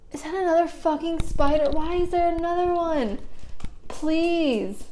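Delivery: high, pleading whine; distressed whine. Real, not acted. Vlogger finds another spider, real distressed whine.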